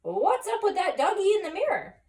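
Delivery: startled by your own reflection; puzzled surprise. Acted. Skippy sees the doggy in the mirror, high surprised question.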